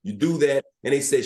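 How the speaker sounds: ordinary talk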